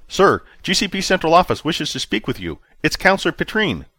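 officer reporting to his commander, high and tense; nervous junior officer. A narrator's reading. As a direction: report urgently like a rattled subordinate.

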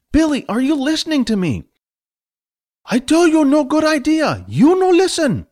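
high scolding voice in broken English; scolding mentor. Acted. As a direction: scold him for not listening.

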